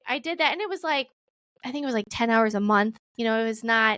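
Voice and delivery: ordinary talk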